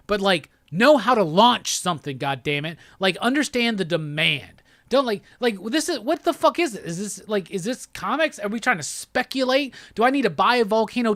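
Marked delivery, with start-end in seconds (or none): none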